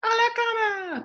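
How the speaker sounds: high, calling call; shouting. A narrator's reading. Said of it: "gritó Fred".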